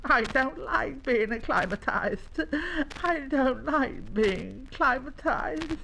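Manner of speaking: high whining little voice, slowing on the repeat; miserable young llama. Acted. Young llama complains after his mishap, miserable. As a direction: whine the complaint like a sorry child.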